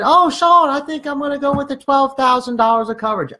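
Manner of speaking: high-pitched imitation of a client; mock client in a high falsetto. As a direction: put on a high, drawn-level client voice. Voicing client Miss Johnson choosing.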